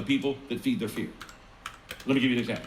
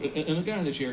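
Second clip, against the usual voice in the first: ordinary talk, nothing marked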